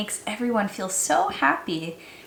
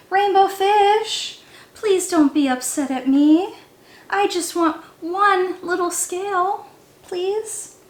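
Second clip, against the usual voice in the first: pleading little fish; 7 semitones higher, 3 dB louder than usual. A narrator's reading.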